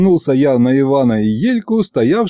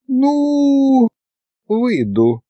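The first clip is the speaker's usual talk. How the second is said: long high coy drawl, then dropping; languorous, stretched-out consent; languid drawl; 13 semitones higher, much slower than usual. A narrator's reading. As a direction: draw it out languidly. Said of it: "томно протянула она".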